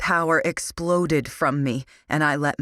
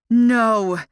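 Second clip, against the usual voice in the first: much higher, loud anguished no; shout; 8 semitones higher, 8 dB louder than usual. A narrator's reading. Prisoner watching her sister's arm broken, anguished shout. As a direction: scream it out in horror. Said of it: "I shouted".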